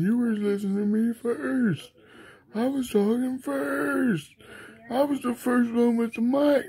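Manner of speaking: a hint of a needy kid sulking; whiny complaining voice. Whiny voice complains about attention.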